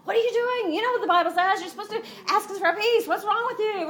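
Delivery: high, accusing and a bit fast; mock accusing. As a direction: scold him as if he's a fool. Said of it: "she didn't come out with that first".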